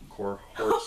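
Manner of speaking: Cohorts redone with a laugh, stretched; laughing retry. Real, not acted. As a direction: laugh at your own slip on Cohorts. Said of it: "Wow, I pulled a U".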